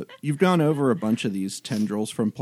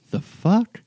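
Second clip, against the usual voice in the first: shocked; 9 semitones higher, 4 dB louder than usual. Acted. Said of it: "you just hear back".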